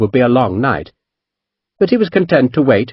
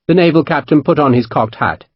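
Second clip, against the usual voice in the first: usual speaking voice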